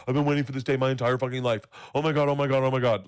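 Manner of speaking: loud, fast gush of mock rapture; acted excitement. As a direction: blurt it out like an overexcited teenager. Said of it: "You're like".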